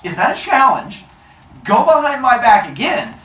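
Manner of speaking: heated, confrontational push; angry challenge. Acted. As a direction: dare them to try it. Zeus challenges Prometheus.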